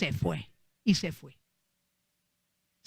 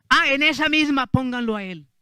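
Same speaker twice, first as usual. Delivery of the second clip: royal command; 5 semitones higher, 5 dB louder than usual. Retold or imitated. King orders Amán hanged.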